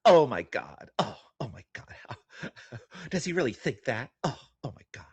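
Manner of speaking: mock-shocked oh my god; mock offended gasp. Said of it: "Look how offended she is".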